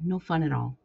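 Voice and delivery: ordinary delivery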